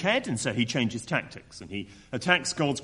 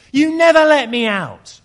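much higher and louder, a furious yell at her parents; teenager's shout; 11 semitones higher, 13 dB louder than usual. Retold or imitated. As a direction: scream it like a teen stuck at home. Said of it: "an older teenager shouting at her parents".

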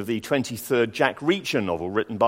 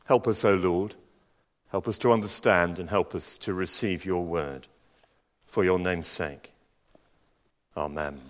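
soft, lower prayerful voice; 5 semitones lower, 4 dB quieter than usual. Acted. Preacher praying for the congregation, hushed. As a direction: pray quietly for understanding. Prays after the reading.

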